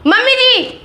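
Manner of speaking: high, urgent call across the house; wife yells for mother-in-law to come. Acted. Wife calls mother-in-law for help.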